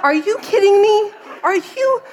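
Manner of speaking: high, loud, hammed-up outrage; mock mad disbelief. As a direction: playact being mad at the praying boy. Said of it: "I start out mad".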